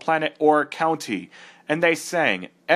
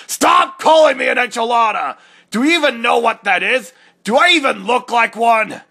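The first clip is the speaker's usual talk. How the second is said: angry shout; 6 semitones higher, 5 dB louder than usual. Acted. Echidna angry at name.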